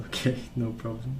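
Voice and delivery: usual speaking voice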